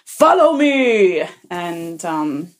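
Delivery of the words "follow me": high, loud, drawn-out mock-stage proclamation; dramatic, forceful Jesus. Teller mimics the church play's bearded Jesus, dramatic and forceful. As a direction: declaim it slowly with arrogant force. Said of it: "gave his lines with, with much, uh, you know, force".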